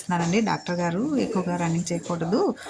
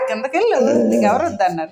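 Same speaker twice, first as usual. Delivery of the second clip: loud, higher scolding; 4 semitones higher, 7 dB louder than usual. Acted. Owner to her pet, ordering it down loudly.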